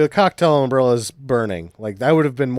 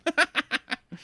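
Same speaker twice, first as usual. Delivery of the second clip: squeaky burst of laughter; high-pitched laugh; 7 dB quieter than usual. Real, not acted.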